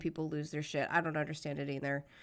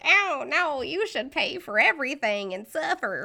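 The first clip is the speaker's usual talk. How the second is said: a lightly done mock Southern accent, higher and louder; 7 semitones higher, 6 dB louder than usual. Mocking Republicans in a hammed-up Southern accent. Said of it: "Specifically doing that in a Southern accent".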